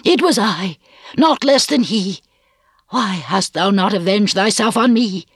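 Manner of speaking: high, anguished cry of confession; shuddering cry. A narrator's reading. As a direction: cry out in distress, pitched high. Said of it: "cried Hester, shuddering".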